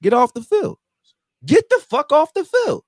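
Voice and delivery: builds from a firm order to a high yell; imitated yell. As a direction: retell the coach throwing the owner off the field with a rising yell. Coach Bill yelling at owner Jerry.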